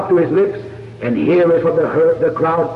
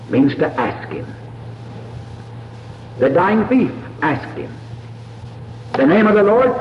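ordinary talk, nothing marked

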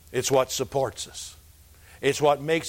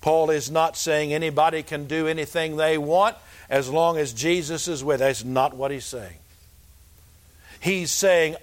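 ordinary delivery

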